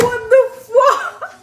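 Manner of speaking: high, slow, laughing shriek of shock; a squealed, laughing what-the; shrieking disbelief. Retold or imitated. Retelling learning she slept with him.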